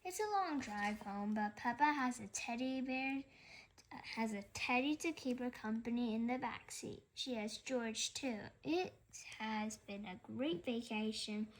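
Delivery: ordinary delivery, nothing marked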